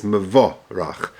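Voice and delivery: "Mevorach" slowly, "me-vo-RACH" with a patach "a" under the reish; careful pronunciation. Shown as a demonstration. Teacher repeating the word for students, slowly. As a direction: lay out "Mevorach" slow and exact, patach on the reish. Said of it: "Upatach Tachas Areich is Mevorach".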